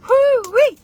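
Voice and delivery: excited rising shout into a high whoop; party whoop. Pretend. Reversing herself on party mood, excited whoop.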